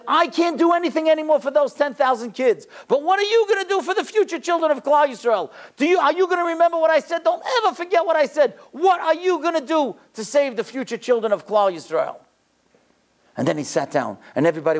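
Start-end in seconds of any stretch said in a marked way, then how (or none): 0.1-5.5 high, ringing anguished challenge; lion-like passion. Retold or imitated. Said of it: "He looked like a lion". 5.8-12.1 high-pitched, impassioned exhortation; lion-like passion. Retold or imitated. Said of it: "He looked like a lion".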